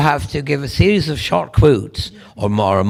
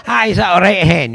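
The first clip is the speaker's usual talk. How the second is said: high, quick Glasgow patter; chirpy Glasgow street accent; 7 semitones higher than usual. Shown as a demonstration. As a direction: speak in broad Glaswegian. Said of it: "A wee Glasgow accent".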